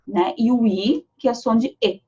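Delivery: ordinary talk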